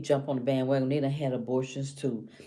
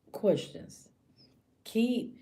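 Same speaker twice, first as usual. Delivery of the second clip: ordinary talk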